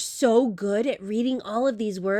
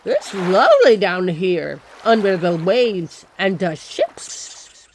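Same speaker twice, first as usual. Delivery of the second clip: puffed-cheek fish; 4 semitones lower, 5 dB louder than usual. Acted. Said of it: "puff out your cheeks and purse your lips".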